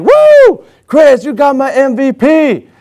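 high, loud imitated whoop easing into slow announcement; retold victory scream; screaming coach. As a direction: cheer like an elated coach. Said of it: "the coach ended up screaming like".

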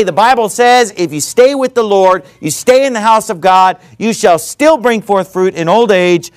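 ordinary delivery

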